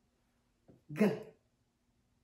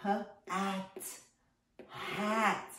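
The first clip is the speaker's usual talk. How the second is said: usual speaking voice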